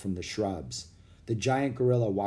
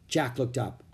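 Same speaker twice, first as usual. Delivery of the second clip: ordinary delivery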